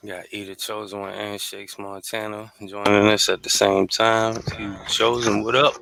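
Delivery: loud host welcome; big, loud welcome to the guests; hype shout-out. Real, not acted. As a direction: call out the guests like a hype man. Calling out to guests joining.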